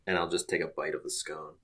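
ordinary talk

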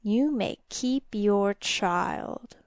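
very slow, teasing creature voice; sly demon. A narrator's reading. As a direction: draw out every word of the dare. Demon sets terms of game.

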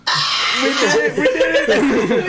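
laughing triumphant cheer; we did it, laughing victory shout; gleeful cheer. Retold or imitated. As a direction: crow with delight, laughing. Celebrating being right, laughing.

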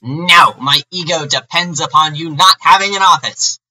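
loud, high, panicked objection; loud protest. Retold or imitated. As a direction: shout it like a childish boss. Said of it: "Van is like".

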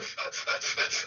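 rapid voiceless puffs of breath; hyperventilating. Shown as a demonstration. Moviegoer acting out his shock, hyperventilating. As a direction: demonstrate panicky quick breathing. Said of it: "I'm hyperventilating".

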